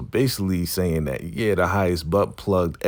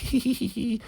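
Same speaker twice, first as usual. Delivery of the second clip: very high, manic tee-hee; put-on gleeful titter; imitated giggling; 5 dB quieter, faster than usual. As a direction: giggle in a tiny falsetto. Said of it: "she's just laughing".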